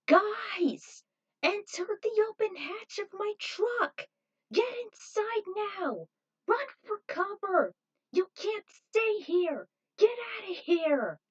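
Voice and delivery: high, loud, slow commands over the noise; urgent shouting. Acted. Victoria shouts to the fleeing group over the ruckus, urgent. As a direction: yell orders through a loudspeaker. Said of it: "She spoke from a loudspeaker over the ruckus".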